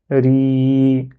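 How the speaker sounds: Ri held long on a level note; prolonged vowel. Shown as a demonstration. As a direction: draw out the i of "Ri" steadily. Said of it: "pour prolonger ce qui vient avant".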